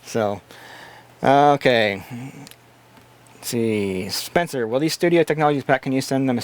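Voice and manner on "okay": usual speaking voice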